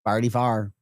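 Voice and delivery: "Farty far" exaggerated local vowels; hammed-up St. Louis "Farty far"; St. Louis accent. Shown as a demonstration. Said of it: "We do have accents here".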